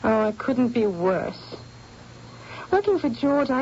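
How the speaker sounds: ordinary talk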